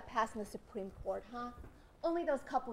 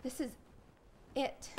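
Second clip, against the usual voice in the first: normal talking voice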